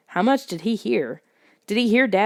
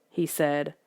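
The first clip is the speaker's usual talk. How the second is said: ordinary delivery, nothing marked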